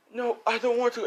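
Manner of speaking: high-pitched acted plea; pleading protest. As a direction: protest like a frightened captive. Captive child protesting in story.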